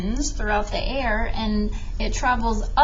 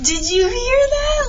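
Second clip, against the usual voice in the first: higher, louder, thrilled at the squeak; excited; 11 semitones higher, 5 dB louder than usual. Real, not acted. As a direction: burst out amazed at the sound. Sponge cup squeaks loudly.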